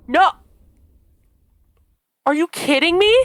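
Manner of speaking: real shocked outrage; outraged disbelief. Real, not acted. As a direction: yell at the screen in disbelief. Player shocked at game choice.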